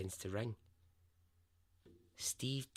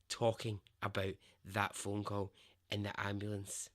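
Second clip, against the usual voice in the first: ordinary delivery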